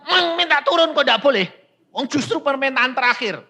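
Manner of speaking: high, loud pleading protest; pleading relative. Retold or imitated. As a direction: argue for the last wish, pitched high. Retold plea from the other. (protes memohon, nada tinggi)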